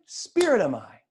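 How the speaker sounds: "Spirit am I" with a decisive fall; statement, not question. Shown as a demonstration. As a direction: affirm "Spirit am I", dropping to the end.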